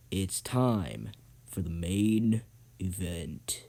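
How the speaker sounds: slow, low, drawn-out ring announcer; main event announcement. Acted. Host introducing the final race, grand slow announcement. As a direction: stretch each word for the big reveal.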